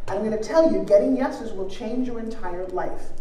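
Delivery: normal talking voice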